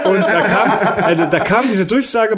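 talking through giggles; words broken by laughter; laughing while talking. Real, not acted. Laughing while telling story.

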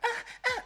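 short, high-pitched mock moans. Man moaning all the time, exaggerated high moans. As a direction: yelp two moans, very high. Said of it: "now he's just like".

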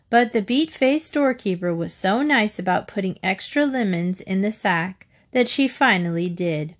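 plain reading voice

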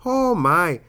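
put-on high old lady exclamation. Shown as a demonstration. Comic showing off an old person voice, high old lady. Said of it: "my old person voice is like an old lady".

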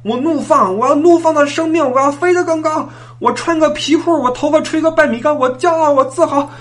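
high defiant shouting rant. Retold or imitated. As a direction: belt it out like a rock anthem. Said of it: "当众大喊".

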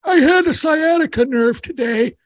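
put-on high, thin old uncle voice; old man voice. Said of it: "Uncle Dave joins and goes".

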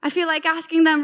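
ordinary delivery

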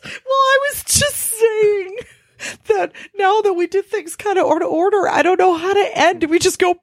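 high-pitched, still rattled; shaken. Real, not acted. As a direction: keep the voice up and rattled. Still shaken after the bug.

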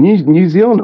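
high-pitched mock Aussie questioning; hammed-up Aussie bloke checking on a stranger; loud Aussie bloke. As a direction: put on a startled Aussie, way up high. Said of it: "this Aussie comes out".

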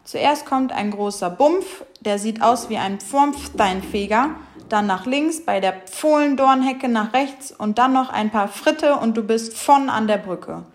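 speech impediment, F in "Bumpf", "Fritte", "von"; every Sch becomes F, as in "Pfropfsteinfeger". A narrator's reading. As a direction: say "Fritte" for Schritte, "von" for schon. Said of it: "Oh weh, so viele Fs".

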